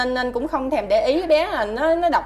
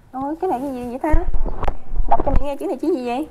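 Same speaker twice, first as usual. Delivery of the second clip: eager quizzing; 3 semitones higher, 5 dB louder, much faster than usual. Real, not acted. Mother quizzing toddler.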